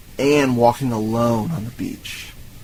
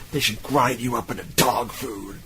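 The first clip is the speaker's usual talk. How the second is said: imitated seething whisper-shout; movie rant squeezed into a whisper; 4 dB quieter than usual. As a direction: hush the shout, mock rage held under the breath. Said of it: "that whisper shouting at her".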